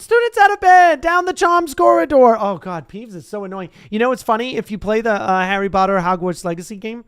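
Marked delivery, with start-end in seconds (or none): 0.0-2.4 high, loud yell; bellowed. Acted. Peeves bellowing the students' location. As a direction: sound the alarm at full voice. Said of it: "Peeves bellowed".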